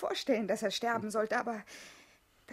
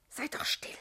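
voiceless hushed plea; 4 dB quieter than usual. Acted. Jessica silencing Hugo's talk of blood, hushed. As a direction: beg him to stop, barely voiced. Hushing him.

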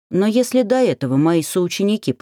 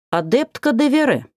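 cutting interruption; 8 semitones higher than usual. A narrator's reading. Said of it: "оборвал меня дракон".

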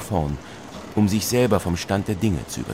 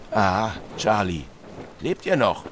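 captain's concern; 3 semitones higher than usual. Acted. Captain speaks to narrator.

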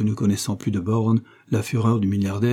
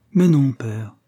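gentle pleading protest, a little raised; weak protest; 4 semitones higher, 5 dB louder, slower than usual. A narrator's reading. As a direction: feeble daughterly objection. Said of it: "dit-elle en protestant faiblement".

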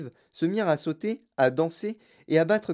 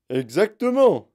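loud, high, drawn-out confirmation; emphatic agreement; 6 semitones higher, 7 dB louder, slower than usual. A narrator's reading. Father backs up his wife, emphatic. Monsieur Gloop agrees in alarm.

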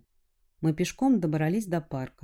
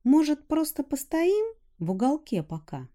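put-on girl voice; 10 semitones higher, 3 dB louder than usual. A narrator's reading. Rusya voiced.

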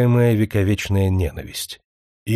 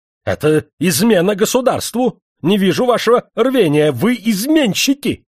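heated accusation; 9 semitones higher, 4 dB louder than usual. A narrator's reading. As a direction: accuse them, rising in fury. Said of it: "горячась заговорил он".

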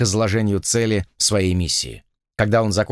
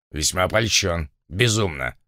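curt; 5 semitones lower than usual. A narrator's reading. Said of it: "отрывисто бросил Блад".

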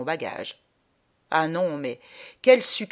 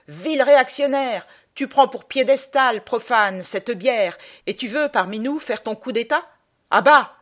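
high, loud heckler's harangue; shouted denunciation; 5 semitones higher, 8 dB louder than usual. Acted. Someone in the crowd denouncing the traveller, shouted accusation. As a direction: cry it out, ending on a louder jeer. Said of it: "Alors quelqu'un cria".

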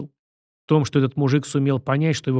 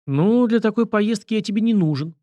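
higher, lightly amused refusal; hidden smirk; 8 semitones higher than usual. A narrator's reading. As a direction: decline while holding back a smirk. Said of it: "пряча усмешку". (пряча усмешку)

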